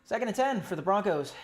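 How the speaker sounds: ordinary delivery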